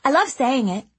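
put-on high, eager chatty girl; bubbly little girl rushing her words; young girl voice. A narrator's reading. Girl dreamy.

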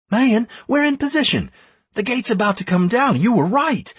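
high-pitched, pressing woman's report; urgent battle report. A narrator's reading. Anastaria reporting to her leader from position, urgent.

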